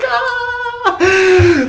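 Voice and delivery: put-on high sung scream of kids; mock children's high shriek. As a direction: wail like a crowd of shocked children. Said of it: "the children are like".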